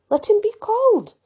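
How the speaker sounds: loud, high-pitched summons; ringing command. A narrator's reading. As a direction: call out an order, pitched well up. Joan commands that paladin be fetched.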